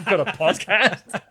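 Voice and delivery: loud, high, drawn-out disbelief; retold amazed question; mock astonishment. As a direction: act out an incredulous friend. Retells friend's astonished text message.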